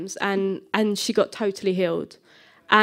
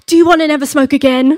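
loud, higher blurt breaking into laughter; sudden boldness; 5 semitones higher, 10 dB louder, faster than usual. Retold or imitated. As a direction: blurt it out boldly, acting it up. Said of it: "I get this boldness come over me".

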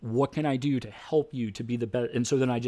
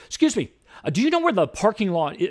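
woman interrupting; 8 semitones higher, 5 dB louder than usual. Retold or imitated. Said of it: "she goes".

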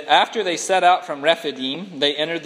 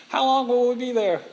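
put-on whiny kid; 6 semitones higher than usual. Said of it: "road trip".

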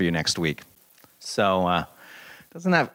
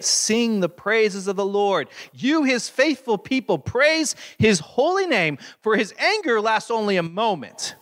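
much higher, slow, ringing praise; fervent psalm proclamation; 10 semitones higher than usual. Acted. Pastor proclaiming the psalm's praise to his flock, fervent and high. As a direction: lift the psalm up as a call to worship.

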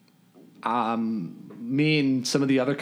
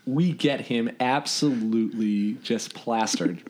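ordinary talk, nothing marked

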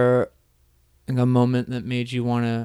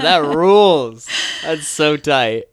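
excited; 4 semitones higher, 7 dB louder, slower than usual. Real, not acted. Enthusing about the story.

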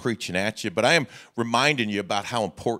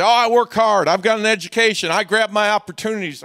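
much higher and louder self-satisfied claim; mock proud boast; 10 semitones higher, 8 dB louder than usual. Preacher mocking a boastful congregant, loud and high. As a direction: puff up like a proud self-made man. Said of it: "you say".

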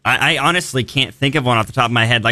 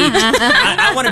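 laughing; slower than usual. Real, not acted. Laughing while picking a kiwi.

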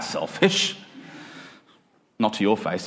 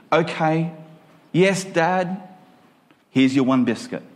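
put-on child voice; 3 semitones higher than usual. Child answering dad about biscuits.

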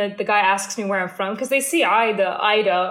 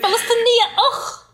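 much higher, laughing echo of the word; mock proud echo; 13 semitones higher, 3 dB louder than usual. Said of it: "Or something".

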